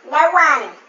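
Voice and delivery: ordinary talk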